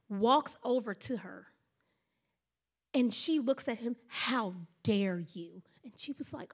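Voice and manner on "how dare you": low, slow, restrained indignation; retold indignation. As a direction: act out a slow, offended confrontation. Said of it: "She was mad".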